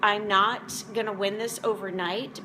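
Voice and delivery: ordinary delivery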